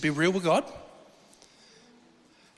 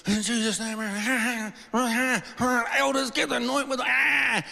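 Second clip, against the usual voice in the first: high, strained shouting-prayer imitation; mock fervent prayer; 6 semitones higher than usual. Elders lay hands on him in the chair, mock fervent prayer. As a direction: act out elders straining as they pray over someone.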